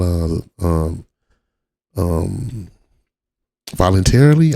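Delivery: ordinary delivery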